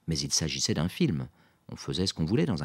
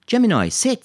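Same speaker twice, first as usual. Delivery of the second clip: loud, high-pitched order; firm dog command; 13 dB louder than usual. A narrator's reading. Lisa makes the Golden sit, commanding. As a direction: call it out sharply to a dog.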